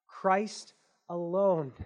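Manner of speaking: ordinary talk, nothing marked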